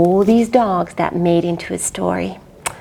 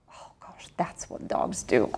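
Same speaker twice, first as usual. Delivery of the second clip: unvoiced whisper; whispered aside; 11 semitones lower, 13 dB quieter than usual. Real, not acted. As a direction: hush it as a secret.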